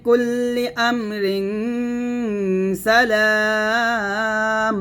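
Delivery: drawn-out tajweed recitation; Quranic recitation. Shown as a demonstration. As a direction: sing the verse out, linking Amrin into সালাম. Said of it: "তাও থামতে পারবে এরকম".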